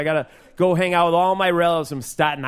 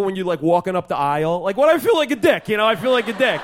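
cousin Joey; 4 semitones higher than usual. Acted. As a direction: do your cousin as an eager amateur comic. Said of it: "this would be my cousin Joey doing stand-up comedy".

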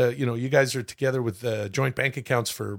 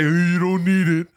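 high, loud mock teasing voice; exaggerated high jeering; mocking imitation; 6 semitones higher, 5 dB louder than usual. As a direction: mimic the mockers in a raised voice. Mimics people who would mock him.

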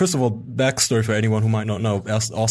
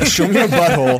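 louder, higher, laughing repeat; loud creepy quote; 7 semitones higher, 6 dB louder than usual. Retold or imitated. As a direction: belt out the creepy line.

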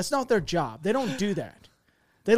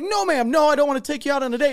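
high, louder, hasty mock denial; panicked refusal; 8 semitones higher, 5 dB louder than usual. Private turning down the LT's sister, panicked. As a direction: rush out a flustered retold no.

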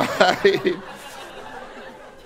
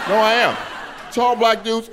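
emphatic shout; 8 semitones higher than usual. Real, not acted.

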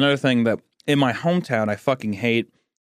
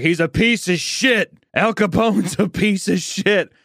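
grandpa's gruff scolding, higher and slow; stern grandpa; 7 semitones higher, slower than usual. Retold or imitated. Grandfather scolding his grandson about Jesse James, in a slow mock-gruff voice. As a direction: scold like an old man fed up with outlaws. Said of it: "And he's like".

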